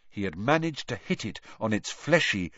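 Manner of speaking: usual speaking voice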